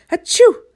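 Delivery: high loud sneeze. Acted. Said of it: "sneezed Little Miss Stubborn".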